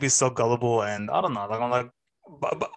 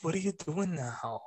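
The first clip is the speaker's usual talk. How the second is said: softly patronizing, pitched up; mimicked condescending tone; 4 semitones higher, 8 dB quieter than usual. Teacher speaking as if to someone homeless, condescending. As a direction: imitate a teacher talking down, hushed and higher. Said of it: "speaking to me with this tone".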